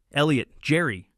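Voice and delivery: sharp, venomous command. A narrator's reading. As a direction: spit the names with venom. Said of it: "her voice dripping with venom".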